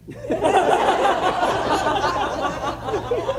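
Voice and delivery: a real laugh at the memory; high laugh. Real, not acted. Preacher laughing at his own story, high laugh.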